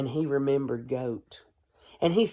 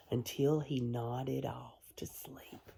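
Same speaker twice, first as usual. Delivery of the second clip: soft whisper; 4 semitones lower, 11 dB quieter, slower than usual. A narrator's reading. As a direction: whisper it softly as he drifts off. Falling asleep in bedtime story.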